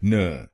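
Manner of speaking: न said alone as a short syllable; syllable demo. Shown as a demonstration. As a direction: model the syllable न for learners. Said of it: "दूसरे शब्दांश को बोलिए न". (शब्दांश न अलग से बोलें)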